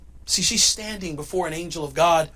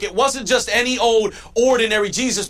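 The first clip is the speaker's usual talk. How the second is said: ordinary talk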